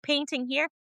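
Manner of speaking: mother mocking nursery décor, lightly sing-song; mock gushing. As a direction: poke fun at the fussing. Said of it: "You think".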